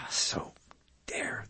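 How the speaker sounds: whispered sigh, fading out; heavy sigh. Real, not acted. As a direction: breathe out, deflated. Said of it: "very depressing".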